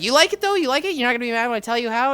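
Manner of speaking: high, quick retold pleading; retold anxious checking. Nephew checking Guga won't get mad, anxious and quick. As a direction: rush it high, seeking reassurance.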